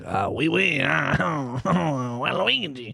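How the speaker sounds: put-on hammy French accent warm-up; clumsy French accent; fake French accent. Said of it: "let me get into character real quick".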